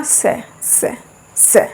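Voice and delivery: "स" as "sha", tongue at the palate; soft, unvoiced palatal hush on "स"; palatal sibilant. Shown as a demonstration. Teacher has students try saying it, palatal "sha" demo on "स". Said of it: "तालव्य, तालु".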